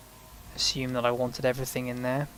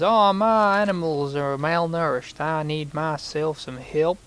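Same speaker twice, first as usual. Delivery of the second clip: put-on American accent; 3 semitones higher, 5 dB louder, slower than usual. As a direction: play a fussy American rancher in a put-on American accent. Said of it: "This is my stupendous American accent".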